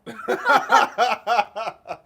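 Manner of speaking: big high laugh bursting out; host cracking up at the clot pun; loud burst of laughter. Real, not acted. Laughing at joke.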